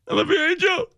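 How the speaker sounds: high, hammed-up sobbing goodbye; fake-sob the farewell up high; mock crying. Host parodying the crying sendoff, high mock sobbing. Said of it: "How would it sound if you did do one".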